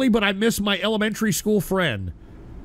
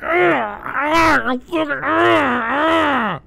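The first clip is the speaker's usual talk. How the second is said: mock meltdown grunts; 3 semitones higher, much slower than usual. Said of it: "she was trying to swallow her own face".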